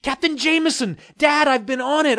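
high and loud, urgent; excited. Acted. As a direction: push it out high and eager. Excited exchange about the ship.

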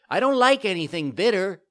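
put-on high, loud whiny puppet child; bratty refusal. A narrator's reading. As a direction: protest like a stubborn child.

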